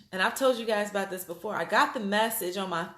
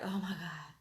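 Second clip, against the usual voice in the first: quiet; 11 dB quieter than usual. Retold or imitated. Relieved exclamation.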